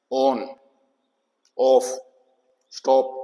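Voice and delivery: on, off, stop with a brief short O; short O sound. Shown as a demonstration. Teacher showing pupils the short O in example words, short O. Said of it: "short ओ है".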